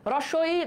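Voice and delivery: রস্বই said slowly, vowels drawn out for the class; vowel demo. Shown as a demonstration. Teacher demonstrates রস্বই to students, slow and clear. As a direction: demo রস্বই with the tongue moving forward. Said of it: "যেমন দেখো, রস্বই".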